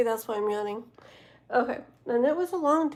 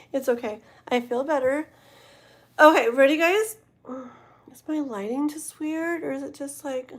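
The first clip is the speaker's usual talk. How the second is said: normal talking voice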